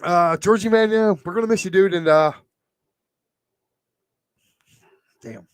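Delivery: choked-up farewell, with long halting pauses; teared up. Real, not acted. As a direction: raise the voice slightly as tears well up. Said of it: "I'm getting a little teared up".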